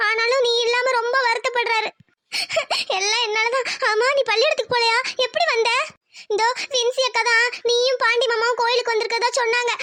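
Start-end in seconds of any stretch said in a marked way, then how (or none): 0.1-3.7 sobbing, higher-pitched start; tearful. Acted. Elder sister blaming herself to her little sister, tearful. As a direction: choke out a tearful self-blame.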